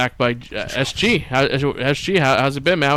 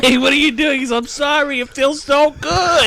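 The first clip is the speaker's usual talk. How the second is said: imitating mom and kid; 10 semitones higher than usual. Retelling nephew caught by mom, high mock voices. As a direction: play both voices high and hammy. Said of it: "And his mom said".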